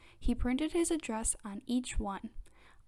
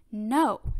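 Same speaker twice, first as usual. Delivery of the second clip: louder, defiant refusal; mild storybook yell; 7 dB louder than usual. A narrator's reading. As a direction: yell it lightly, without full force. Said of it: "yelled Franklin".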